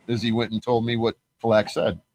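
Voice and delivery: normal talking voice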